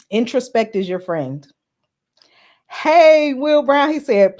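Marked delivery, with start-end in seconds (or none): 2.7-3.9 loud, high, happy shout-out; excited greeting. Real, not acted. Calling out as a viewer joins the live stream. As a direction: call the name out like spotting a friend.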